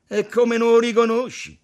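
loud, high, thick-voiced retort; thick, mellow mockery. A narrator's reading. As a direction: answer loudly with a doughy relish. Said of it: "fece pastoso il Buddha". (risposta pastosa e canzonatoria)

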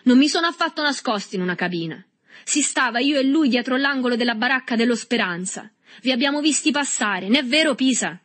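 high, loud argument; heated protest. A narrator's reading. Boy denies hiding and calls a witness, shouting. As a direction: protest loudly and appeal to a witness. Boys quarrel, denial and appeal.

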